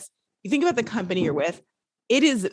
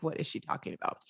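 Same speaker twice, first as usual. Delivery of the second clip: whispered, baffled aside; mock puzzled whisper; 12 dB quieter, faster than usual. Imagined British listener puzzled, whispering. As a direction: breathe it out like a confused Brit. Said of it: "going like".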